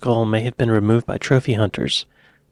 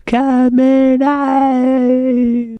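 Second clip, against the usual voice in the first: loud, drawn-out mock British accent, climbing higher; bad British accent; 7 semitones higher, 5 dB louder, much slower than usual. Reactor mimics commentators blaming the manager, bad British accent. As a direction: put on a hammy British commentator, stretching the vowels. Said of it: "with my bad British accent".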